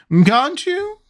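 high-pitched pitying question. A narrator's reading. Queen to disbelieving Alice, pitying. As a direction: ask it with lofty pity, pitched right up. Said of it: "said in a pitying tone".

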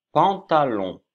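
pantalon détaché, plus haut et fort; articulé pour la classe. Shown as a demonstration. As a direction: dites bien pantalon, syllabe par syllabe. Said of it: "Ça, c'est un pantalon". (pantalon articulé fort pour les élèves)

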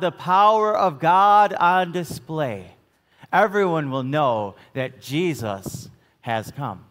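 ordinary delivery

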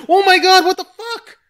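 watching a grim film, laughing in disbelief; shocked outburst. Retold or imitated. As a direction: shriek it out through a laugh. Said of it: "I'm, like, watch this movie".

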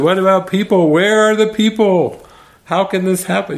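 high-pitched mock rant; hammed-up celebrity tirade; venting rage. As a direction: imitate an outraged plea, far above normal pitch. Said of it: "venting her rage".

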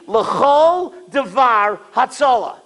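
measured, solemn recitation; slow text reading. Shown as a demonstration. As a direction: read the Hebrew very slowly. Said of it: "Listen to what the Satmar Rebbe read".

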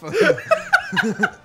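high squealing laughter through words; cracking up while talking; laughing hard. Real, not acted. Laughing hard mid-sentence.

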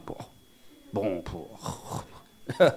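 imitating a speaker running out of words; trailing fillers. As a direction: let the fillers die away, mock-hesitant. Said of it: "just speaking like that".

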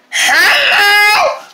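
high, loud squawking lady; strange lady voice. Acted. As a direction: screech a greeting like a loud flamingo lady. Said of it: "what is wrong with your voice?".

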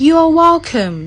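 slow, strange-toned You are welcome; drilled reply. Shown as a demonstration. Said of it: "ท่องมาว่า". (พูด You are welcome ช้าๆ แบบท่องจำ)

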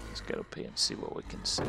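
hushed whisper. Real, not acted. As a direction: breathe it out quietly. Sneaking, whispering commentary.

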